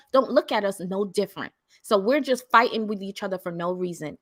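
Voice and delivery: ordinary delivery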